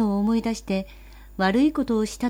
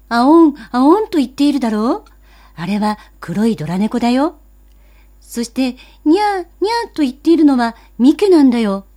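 put-on excited child, higher and loud, mewing the cat calls; boy mimicking cats; 4 semitones higher, 8 dB louder than usual. Boy explains the cat fight to his sister, meowing excitedly. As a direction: paint the cat fight with playful meows.

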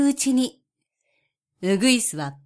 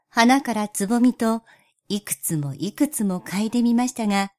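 ordinary delivery, nothing marked

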